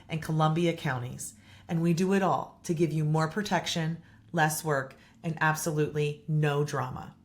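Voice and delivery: soft, measured sponsor voice; host reading a property management ad. Acted. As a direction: read the ad smooth and steady. Sponsor ad read.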